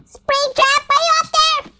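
high far-off call to Springtrap. Acted. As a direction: sing out his name up high. Calling out to the animatronic.

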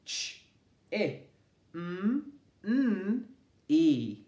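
Ch- i m- n- e, each sound said alone and clipped apart; a phonics teacher segmenting for children; segmenting phonemes. Shown as a demonstration. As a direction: sound out "Chimney" one phoneme at a time.

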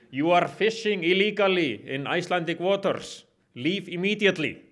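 put-on strong Icelandic accent. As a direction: imitate a gunboat captain hailing a trawler, heavy Icelandic accent. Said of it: "shout in strong Icelandic accent".

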